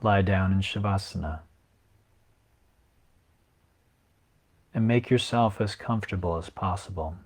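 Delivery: even script reading with long pauses; guided meditation. Acted. Yoga teacher reading Yoga Nidra to resting listeners, guided meditation. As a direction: guide listeners unhurriedly, leaving long silences between phrases.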